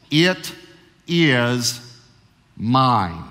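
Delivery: it, is, mine. each word split by pauses and pressed; emphatic reading. Shown as a demonstration. Pastor reads God's claim on the firstborn to the congregation, word by word. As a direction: lay "it is mine" down slow, one word at a time. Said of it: "It's extremely emphatic".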